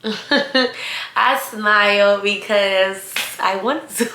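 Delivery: slow, drawn out through giggles; laughing confession. Real, not acted. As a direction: own up to it while breaking into laughter. Amused confession, laughing as she speaks.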